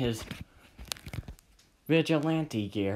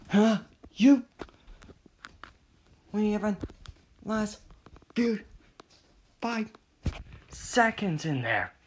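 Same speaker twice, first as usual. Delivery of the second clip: high-pitched annoyed challenge; worked-up, mock-angry rival; pissed; 8 semitones higher than usual. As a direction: take them on, irritated and high. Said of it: "getting pissed".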